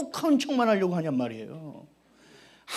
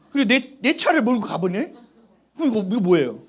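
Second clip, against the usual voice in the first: ordinary talk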